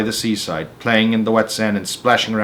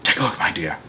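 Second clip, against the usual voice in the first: put-on quiet old man; 5 semitones lower, 6 dB quieter, faster than usual. Old man tempting James.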